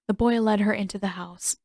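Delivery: usual speaking voice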